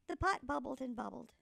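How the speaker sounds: ordinary delivery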